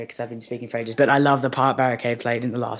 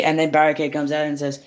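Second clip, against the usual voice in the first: normal talking voice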